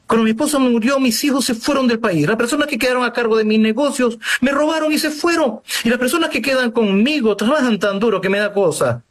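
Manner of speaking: high-pitched, pressing account of woes; agitated lament. Acted. Man telling the priest why he is leaving, agitated lament. Acting a man recounting his losses.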